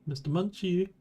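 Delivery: high, far-off Mister Munchie; calling out. Pretend. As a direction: call out for him. Calling out in the dark.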